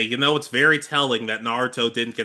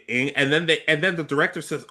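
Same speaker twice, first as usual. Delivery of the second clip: normal talking voice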